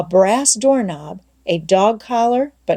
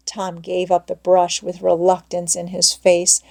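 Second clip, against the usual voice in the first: ordinary delivery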